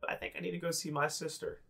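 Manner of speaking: a small goblin insisting nervously. Acted. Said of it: "Heckler goes".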